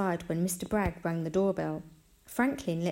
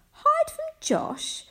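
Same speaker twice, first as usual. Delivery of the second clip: very high, slow, astonished question; incredulous; 5 dB louder, slower than usual. A narrator's reading. Mum puzzled that her son would hide, incredulous. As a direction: echo it back in high-pitched disbelief.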